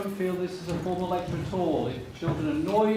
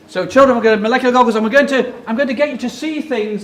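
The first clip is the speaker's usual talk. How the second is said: much louder, fast and bright; excited; 3 semitones higher, 9 dB louder than usual. Real, not acted. Lecturer firing up the children for the activity, excited and loud.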